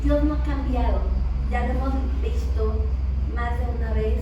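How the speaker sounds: loud, ringing pulpit proclamation; full-force preaching. Acted. Preacher proclaiming to the congregation that God has not changed, loud. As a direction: preach it out to the whole hall.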